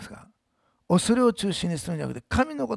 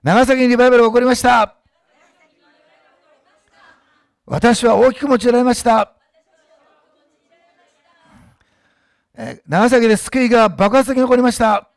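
loud call and response; 6 semitones higher, 11 dB louder than usual. Shown as a demonstration. Pastor calling out declarations for the church to repeat. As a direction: declare it boldly, line by line. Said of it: "私の後に言ってください。".

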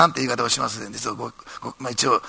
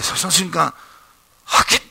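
ordinary talk, nothing marked

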